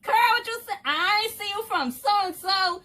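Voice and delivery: high, loud friendly greeting; greeting Kurt now that she can see, high and loud; eager hail. Retold or imitated. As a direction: call out to an old friend, delighted. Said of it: "I can hail you like".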